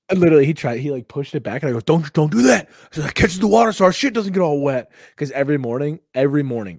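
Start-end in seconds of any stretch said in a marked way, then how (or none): 1.8-4.9 higher, louder mock-frantic protest; retold frantic warning. Stopping a friend moving the paper towel, retold frantic warning. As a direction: blurt it out to stop them.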